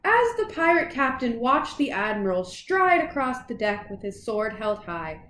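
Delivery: plain reading voice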